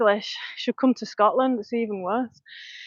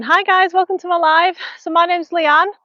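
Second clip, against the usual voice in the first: live stream greeting; 6 semitones higher, 3 dB louder than usual. Real, not acted.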